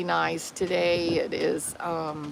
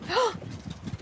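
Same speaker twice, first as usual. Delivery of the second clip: surprised gasp; 12 semitones higher, 8 dB quieter than usual. Real, not acted. Sudden surprise at the dog.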